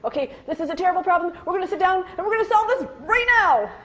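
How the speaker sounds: fast, loud, high, frantic push to fix it now; panicked rush. Retold or imitated. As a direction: blurt it out all worked up. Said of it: "If you're nervous".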